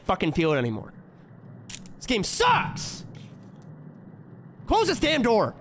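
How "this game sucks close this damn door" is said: exasperated, pitched well up; high-pitched gamer frustration; exasperated outburst. Real, not acted. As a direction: cry out in frustration at the game.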